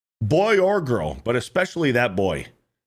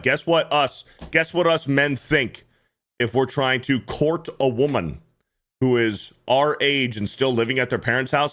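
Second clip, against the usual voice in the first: ordinary talk, nothing marked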